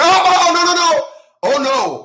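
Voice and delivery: high, loud burst of rapid no's; Mister Daniels. Acted. Imitating a host cutting a caller off, mock alarmed protest. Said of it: "That's why Mister Daniels said".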